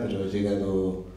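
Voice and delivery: usual speaking voice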